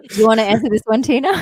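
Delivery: loud, high question through real laughter; laughing. Real, not acted. Bandmate handing Tina the throat-singing question, laughing. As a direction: laugh while passing the question on.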